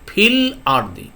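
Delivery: ফিল আরদি said slowly, joined, with no glottal stop before আরদি; slow demonstration. Shown as a demonstration. Teacher showing students the elision, slow careful demo. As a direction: draw out ফিল আরদি slowly so the link is heard. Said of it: "এখানে দেখেন ফির পরে".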